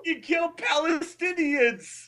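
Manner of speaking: caricature captor. Pretend. Friend playing captors returning, high caricature voice. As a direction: burst in with a shrieked comic villain voice.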